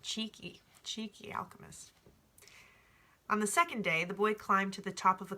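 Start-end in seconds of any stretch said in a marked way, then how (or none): 0.0-1.9 soft aside. Real, not acted. Reader comments on character.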